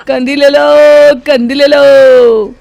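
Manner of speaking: loud, high, drawn-out sales call; street hawker's cry. Acted. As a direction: stretch out each call like a street vendor. Seller resumes hawking. (फेरीवाले की लंबी, ऊँची पुकार)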